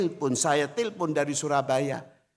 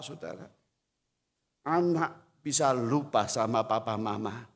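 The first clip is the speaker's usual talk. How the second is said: ordinary talk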